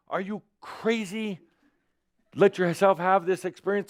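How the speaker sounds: high-pitched, frantic acted scolding; the panicking mind. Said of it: "I'm playing the part of the mind".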